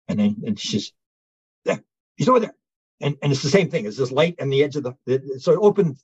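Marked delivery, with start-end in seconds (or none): 1.6-2.6 excited pointing out. Retold or imitated. Woman points out the being.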